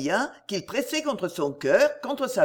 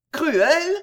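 high and loud, a stunned echo of his word; 8 semitones higher, 6 dB louder than usual. A narrator's reading. Camilla echoes Edgar's word, stunned and high-pitched. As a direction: throw the word back in disbelief. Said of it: "répéta Camilla".

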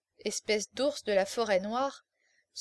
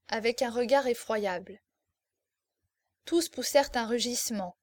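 usual speaking voice

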